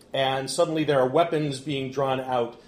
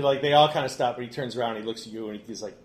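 normal talking voice

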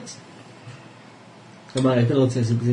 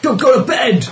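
warning cry; 8 semitones higher, 5 dB louder than usual. Retold or imitated. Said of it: "he tells she's a good girl, go".

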